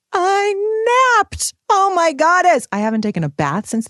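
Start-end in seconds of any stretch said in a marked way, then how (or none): 0.1-1.5 high, loud burst then drop; triumphant exclaim. Real, not acted. Mom proudly telling listeners she napped, exclaimed. 1.7-2.7 high, loud gasp of joy; delighted exclaim. Real, not acted. Mom reliving her nap, loud delighted cry.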